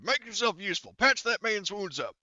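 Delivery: loud, high-pitched barked command; drill sergeant order. Acted. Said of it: "Drill Sergeant Horgus is going to order".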